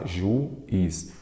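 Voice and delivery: juiz split as "ju... is"; syllable by syllable. Shown as a demonstration. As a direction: break juiz into two separate syllables, ju and is. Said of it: "vamos lá". (juiz separado em duas sílabas, ju e is)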